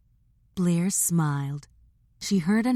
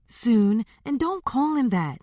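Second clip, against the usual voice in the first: higher-pitched mild scolding; 6 semitones higher than usual. A narrator's reading. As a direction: scold your sister for the nickname. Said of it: "Blair scolded".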